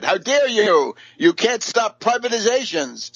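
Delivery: high, indignant how dare you; mock outrage. Speaker mocking the socialist leader's indignant outrage. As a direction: parody a pompous scold. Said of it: "he said".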